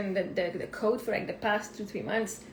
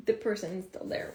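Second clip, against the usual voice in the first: usual speaking voice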